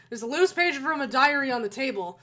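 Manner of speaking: ordinary talk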